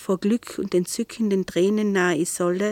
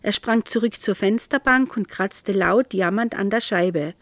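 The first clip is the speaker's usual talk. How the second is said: ordinary delivery, nothing marked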